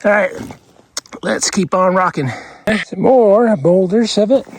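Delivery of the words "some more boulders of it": ordinary talk, nothing marked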